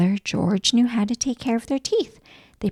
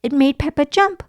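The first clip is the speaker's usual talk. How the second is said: plain reading voice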